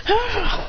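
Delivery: high "ah" exclamation; imitated cry. Character finally grabbing the flag after impact, high cry. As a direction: let out a short high cry.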